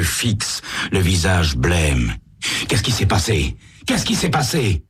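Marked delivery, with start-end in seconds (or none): 2.7-4.8 urgent questioning. Acted. Sam finds the bloody scene.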